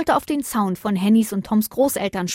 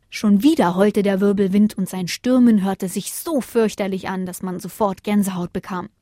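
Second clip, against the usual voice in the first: plain reading voice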